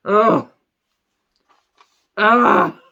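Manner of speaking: loud, theatrical groans; loud fed-up groaning; exasperated groan. Acted. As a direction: groan in mock despair at a bad film. Groans in despair at the film.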